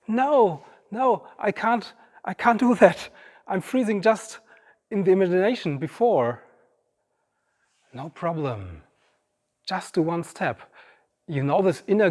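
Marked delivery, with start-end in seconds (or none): 0.1-6.4 high-pitched protest at the cold water; whiny refusal. Retold or imitated. Acts reluctant self at cold water. 7.9-10.6 soft reassurance. Retold or imitated. Coaxing voice reassures hesitant self.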